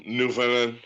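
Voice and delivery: usual speaking voice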